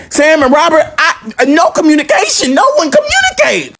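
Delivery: climbing to a high-pitched squeal; exasperated outburst. Real, not acted. Venting that no siblings communicate, exasperated squeal. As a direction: let exasperation push the voice way up.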